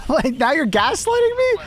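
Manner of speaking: high, laughing indignant squeal; outraged disbelief. Real, not acted. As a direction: pitch up in disbelief. Teammate blames him after shooting.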